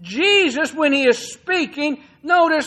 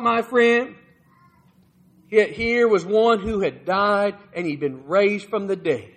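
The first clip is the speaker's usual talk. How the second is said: ordinary talk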